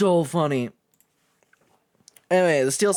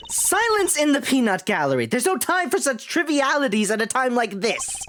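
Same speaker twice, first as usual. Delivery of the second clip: raised, mildly pompous scolding; acted indignant reprimand, pitched higher; indignant outburst; 7 semitones higher than usual. Game character silencing the peanut gallery, indignant.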